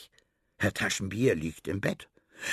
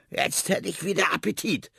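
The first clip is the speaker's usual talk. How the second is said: rat voice; 9 semitones higher than usual. Acted. Rat voiced, asking for food.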